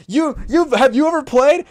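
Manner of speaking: imitating an annoyed player on a call; mock angry Chet. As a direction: play the offended star shouting back. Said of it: "Chet's like".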